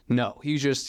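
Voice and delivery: normal talking voice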